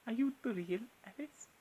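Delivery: lightly voiced Wonderland figure; character voice. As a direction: coo the question like a dreamy character. Trying out Alice character voices.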